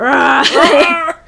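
loud roar breaking into a laugh; hammed-up beast roar through laughter; mock roar. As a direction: roar back like a wild animal, laughing. Joining the mock roaring.

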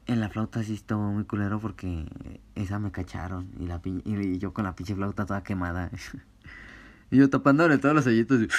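ordinary talk

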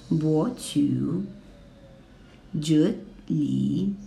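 博取 rising, then a low dip; rise, then sink low on 集体; second plus third tone. Shown as a demonstration. Teacher modelling 博取 for students, rising then low dip. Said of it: "Now second and third tone".

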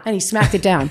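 ordinary delivery

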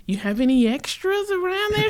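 hopeful in-law angling for leftovers; begging brother-in-law. Retold or imitated. Brother-in-law asking Mel for spare cake, pleading.

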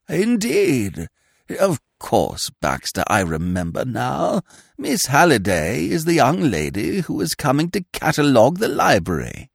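put-on high, fluttery voice of a vague old lord; a dithering aristocrat caught off guard, pitched high; flustered old earl. Vague old earl startled by his secretary, high and flustered.

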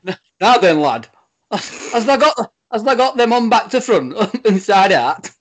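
high, loud mock northern accent, laughing; Yorkshire-ish lad voice. [name] redoing his own canal line in a mock northern accent. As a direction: ham up a northern "now then, lad". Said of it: "I'll do it".

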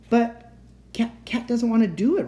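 high, halting objection; protesting. A narrator's reading. Boy stands up for the captive, protesting. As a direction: object urgently, stumbling at the start. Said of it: "Robbie protested".

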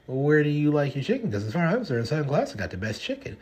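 ribbing a friend about chicken spots; playful challenge. Retold or imitated. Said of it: "And I'd be like".

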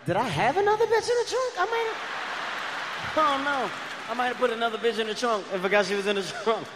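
second-guess yourself in shaky disbelief; confused doubt. Retold or imitated. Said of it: "I was like".

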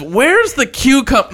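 ordinary delivery

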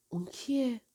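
quiet question. A narrator's reading. Kazu asking Nagare about the girl, hushed. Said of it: "با صدای آرام گفت".

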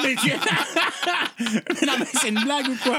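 laughing. Real, not acted. Laughing disbelief.

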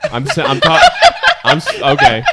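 breaking into laughter; can't stop laughing. Real, not acted. Laughing fit mid-sentence.

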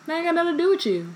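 high, fast sassy clapback; sassy snap. Acted. Hermione snapping at Ron.

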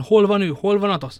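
loud, high alarmed shout; crying out. A narrator's reading. D'Artagnan demands of the innkeeper where his friend is, shouting. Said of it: "kiáltott fel a D'Artagnan".

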